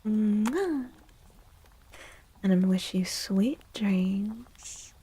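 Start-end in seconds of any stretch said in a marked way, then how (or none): none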